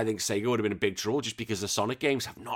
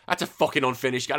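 ordinary talk, nothing marked

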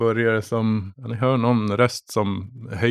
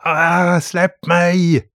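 pitched-up pained cry; mock scream; 6 semitones higher, much slower than usual. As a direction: yelp as your hair is yanked. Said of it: "sliter upp han ... och skriker".

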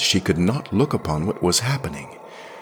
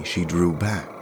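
ordinary delivery

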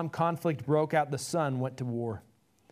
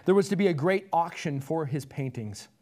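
ordinary delivery

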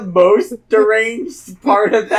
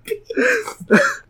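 wheezing through helpless laughter; laughing; slower than usual. Real, not acted. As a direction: barely get words out laughing. Cracking up at the story.

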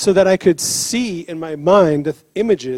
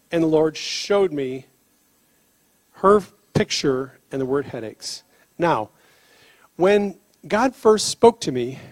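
usual speaking voice

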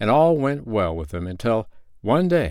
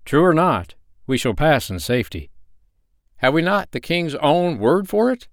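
higher, louder, firm; confident traveler; 8 semitones higher, 3 dB louder than usual. Acted. Other friend reassures his companion, firm. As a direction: reassure with conviction. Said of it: "said the other".

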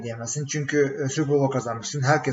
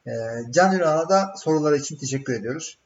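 usual speaking voice

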